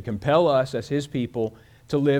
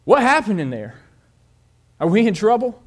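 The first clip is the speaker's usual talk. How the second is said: put-on anxious boy; 7 semitones higher, 4 dB louder than usual. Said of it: "he looks up at him and he says".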